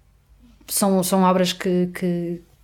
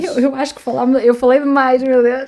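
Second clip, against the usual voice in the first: higher, louder, flustered laugh; embarrassed exclaim; 6 semitones higher, 6 dB louder than usual. Real, not acted. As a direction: exclaim in mock dismay. Embarrassed she talked too much.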